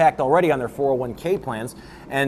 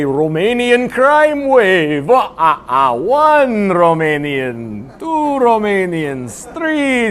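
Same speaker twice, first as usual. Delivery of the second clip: very slow, booming drawn-out count; mock counting count; 3 semitones higher, 4 dB louder, much slower than usual. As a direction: draw each number out theatrically. Mock vampire-count counting of Romanians.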